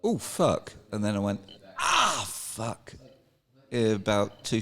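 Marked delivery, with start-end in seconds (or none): 0.0-0.7 high-pitched mild "oh" of dawning trouble; retold alarm. Retelling own reaction to fall. 1.8-2.9 high falling mock yelp; retold pained cry. Retelling delayed pain after fall.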